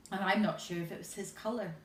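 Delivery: plain reading voice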